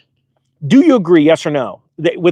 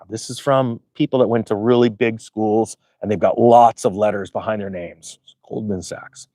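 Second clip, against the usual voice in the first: low, grave, quieter tone; serious voice; 10 semitones lower, 5 dB quieter than usual. Acted. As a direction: drop into a dead-serious delivery. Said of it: "I gotta be serious now".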